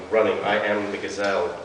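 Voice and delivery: plain reading voice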